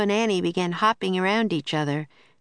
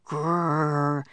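dog growl; 2 semitones lower than usual. A narrator's reading. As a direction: growl like a small dog sensing danger. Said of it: "Teddy growled".

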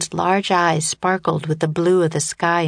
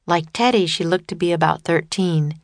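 usual speaking voice

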